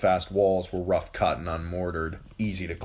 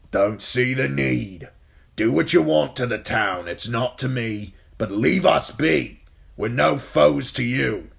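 put-on gruff old man; 6 semitones higher, 6 dB louder than usual. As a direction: answer the knight flatly, calling down. Old Yoren answering the knight.

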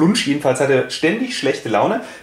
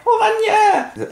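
very high whoop with a laugh; rallying cry. Pretend. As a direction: cheer it like a football chant.

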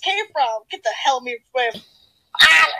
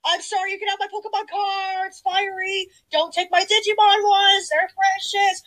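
high, hammed-up whimper; tearful pleading; 5 semitones higher, 3 dB quieter than usual. Pleading to keep precious Digimon cards, tearful. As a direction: beg in a small, high voice.

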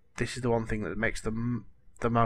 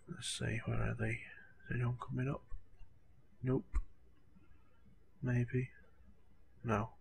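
hushed whisper; 11 dB quieter than usual. Real, not acted. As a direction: whisper softly while scanning for enemies. Quiet peek during sniping gameplay.

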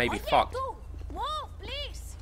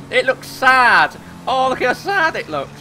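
slow, louder, higher cooing over "sad"; mock pity; 8 semitones higher, 5 dB louder, slower than usual. Pitying the wrecked car's face, mock sympathy. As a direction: coo at it like a sad puppy.

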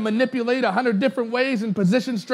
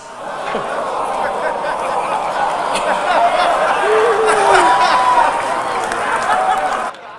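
long silent wheezing laugh; breathless, high, helpless laughter; 8 semitones higher, 3 dB louder than usual. Real, not acted. Cracks up at the line.

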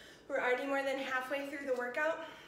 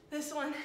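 breathless laughing sigh. Real, not acted. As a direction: laugh out the exhaustion. Exhausted after set.

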